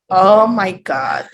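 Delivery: slow, drawn-out shocked Oh my God; horrified at a grammar error; stunned, dragging Oh my God; shocked disbelief. Pretend. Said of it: "Esta es tu cara".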